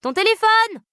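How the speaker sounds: high, slow, drawn-out call across the room. Acted. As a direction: shout it across the flat. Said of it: "cria Rosalie".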